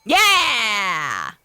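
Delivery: loud, long, falling shout; triumphant cheer. Real, not acted. Player celebrating her escape plan, triumphant shout. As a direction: whoop it out in celebration.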